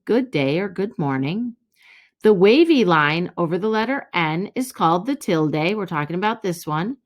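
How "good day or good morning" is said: ordinary talk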